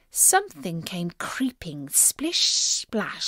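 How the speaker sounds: plain reading voice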